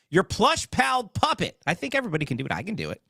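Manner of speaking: normal talking voice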